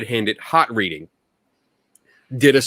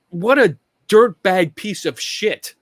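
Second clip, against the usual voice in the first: higher, heated outburst of real anger; raised, angry outburst at a ghost hunter; 8 semitones higher than usual. Real, not acted. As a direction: spit out the insult in real anger. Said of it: "I'm getting angry".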